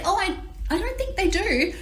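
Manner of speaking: high, soft, hesitant retold reply; tentative disagreement. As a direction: imitate a timid, polite objection. Retold timid disagreement with the hostess.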